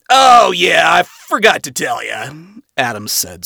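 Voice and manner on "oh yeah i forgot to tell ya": smirking. Acted. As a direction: rub it in smugly.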